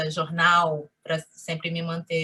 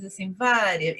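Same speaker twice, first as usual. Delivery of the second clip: ordinary talk, nothing marked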